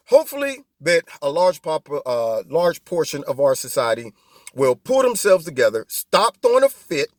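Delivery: normal talking voice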